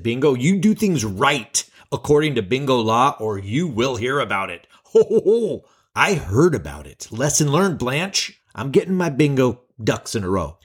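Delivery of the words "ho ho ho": high mocking ho ho ho. Bingo ladies scolding her.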